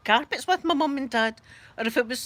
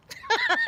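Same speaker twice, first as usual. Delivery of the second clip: short, very high giggle; real quick burst of laughter; amused laugh. Real, not acted. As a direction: giggle mid-story. Laughing while retelling.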